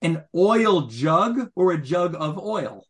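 slow contrast of "An oil jug" and "a jug of oil", stressing "oil" each time; teacher spelling out "oil jug" versus "jug of oil"; contrast stressed. Shown as a demonstration. Teacher asking the class to hear the difference, slow and stressed. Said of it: "you hear the difference?".

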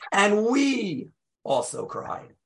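"we" held long and high, then "also cried" falls away; slow, drawn-out emphasis on "we"; stress on we. Shown as a demonstration. Rabbi re-reads the verse for congregation, stress on "we". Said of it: "You hear the emphasis on a different syllable".

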